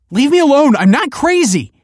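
very high, loud panicked shriek; frantic scream. A narrator's reading. Pupil fending off straitjacket, screaming frantically. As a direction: scream it at full pitch, desperate. Cornered girl screams at approaching man.

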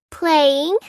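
put-on high, rising child's answer; giggly little girl. A narrator's reading. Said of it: "giggled Lucy".

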